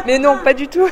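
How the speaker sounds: amused denial; laughing reassurance. Real, not acted. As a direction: laugh off the self-mockery. Reassuring the old ladies.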